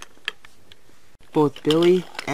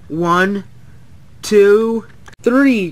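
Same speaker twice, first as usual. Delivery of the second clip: countdown climbing high and loud; cried countdown; 6 semitones higher, 7 dB louder, much slower than usual. A narrator's reading. Billy counts down to scare an engine, rising cry. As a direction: build to a cry on three. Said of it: "cried Billy".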